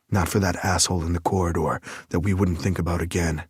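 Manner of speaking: ordinary talk, nothing marked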